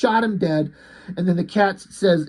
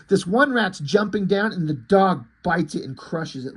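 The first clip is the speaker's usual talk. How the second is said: ordinary talk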